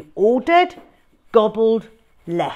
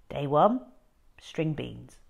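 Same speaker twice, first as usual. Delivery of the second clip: ordinary delivery, nothing marked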